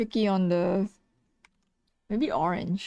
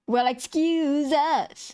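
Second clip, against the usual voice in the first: mock indignation; 5 semitones higher, much slower than usual. Mock offence at viewers' remark.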